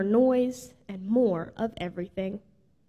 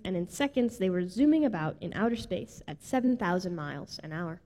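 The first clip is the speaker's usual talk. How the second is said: usual speaking voice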